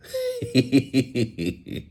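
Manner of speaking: high giggle at a joke. Real, not acted. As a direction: break into a high run of giggles. Amused by comment just read.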